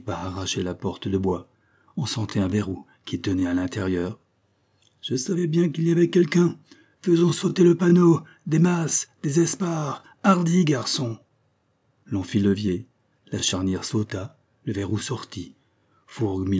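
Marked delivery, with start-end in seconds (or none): 5.0-11.2 high, loud rallying orders; excited skipper calling for action; commanding captain. Acted. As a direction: rouse the men to break through. Captain orders the hatch forced.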